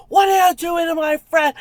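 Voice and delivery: high, loud imitated challenge; confrontational shout. Friend stepping up as a fight breaks out, confrontational. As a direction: call it out sharply, as the friend stepping in. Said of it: "she stepped up and then a fight broke out".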